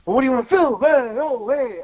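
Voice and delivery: very high fake-Italian wiseguy; Fat Shitso impression. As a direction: ham up a clueless cartoon Italian goombah. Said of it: "fake Italian guy".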